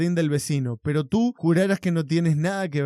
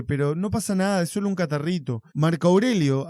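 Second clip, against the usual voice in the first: usual speaking voice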